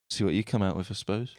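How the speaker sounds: ordinary talk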